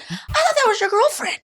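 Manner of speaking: high, astonished put-on voice; mock shock. Catching the guys cheating, high mock surprise. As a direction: gasp it out in high disbelief. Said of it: "I said".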